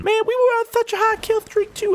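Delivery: a mock squeaky complaint; whiny squeak. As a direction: whine it like a cartoon. High whiny lament.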